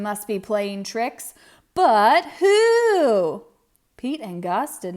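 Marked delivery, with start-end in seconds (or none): 1.7-3.4 loud rising mystery; dramatic question. A narrator's reading. Narrator building suspense for young listeners, loud wonder. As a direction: ask it like a big puzzle.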